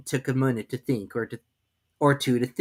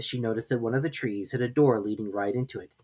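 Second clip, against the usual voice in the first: plain reading voice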